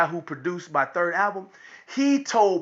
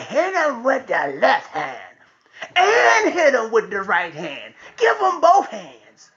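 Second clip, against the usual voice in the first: bloodthirsty egging on; slower than usual. Retold or imitated. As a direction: imitate a child urging on a beating, stretching the words. Daughter eggs father on, acted out.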